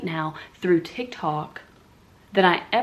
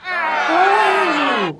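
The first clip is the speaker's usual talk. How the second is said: loud, high drawn-out mock "oh"; scandalized reaction; 10 semitones higher, 8 dB louder than usual. People hearing she's a bikini barista, loud high oh. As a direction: mimic people whooping at the news. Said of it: "they go".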